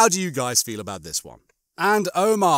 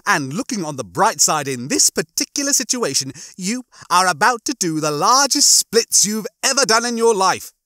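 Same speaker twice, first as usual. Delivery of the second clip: high, gleeful gloating, getting louder; Rimmer; 8 semitones higher, 5 dB louder than usual. Acted. Rimmer tells Lister of his coming death, gleefully. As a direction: relish the bad news, building in pitch and volume.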